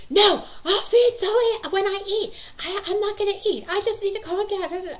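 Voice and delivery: put-on loud, very high whiny child yell; imitated tantrum. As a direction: mimic a child screaming back mid-meltdown. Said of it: "screaming and crying".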